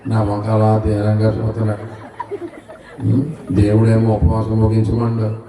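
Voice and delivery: ordinary talk, nothing marked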